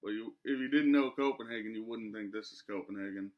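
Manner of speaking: usual speaking voice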